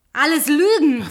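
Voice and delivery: furious loud cry; angry shout. Acted. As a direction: throw the word lies at him. Angry outburst at liar.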